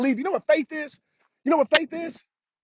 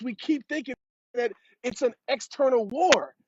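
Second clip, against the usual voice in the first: ordinary delivery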